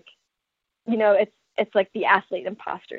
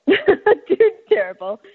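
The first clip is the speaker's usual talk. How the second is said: laughing; 8 semitones higher, 4 dB louder, much slower than usual. Real, not acted. Repeats it laughing, drawn out.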